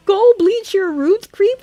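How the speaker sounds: high, loud, slow repeat of a yelled insult; imitated yell rising into a question; incredulous mock yell. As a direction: quote it back in squeaky-high disbelief. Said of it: "Mercury then yells at Malachite".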